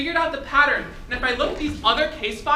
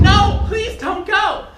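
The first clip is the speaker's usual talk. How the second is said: pleading; 6 semitones higher, much slower than usual. Acted. Begging caller not to hang up.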